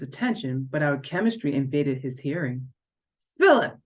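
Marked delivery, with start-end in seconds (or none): 3.4-3.8 sharp high snap of his name; angry snap. A narrator's reading. Jealous dance partner snaps at Philip, angry. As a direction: bite off the name, furious. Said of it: "she snapped".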